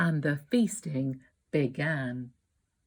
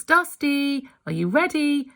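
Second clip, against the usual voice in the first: higher, louder call through a letterbox; calling through the door; 8 semitones higher, 5 dB louder than usual. A narrator's reading. Friend calling through the letterbox, loud and high. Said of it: "called through the letterbox".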